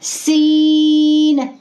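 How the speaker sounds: सीन stretched very long with whistling स; drawn-out seen. Shown as a demonstration. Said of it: "इसको खींच के पढ़ना होता है". (सीन खींच के)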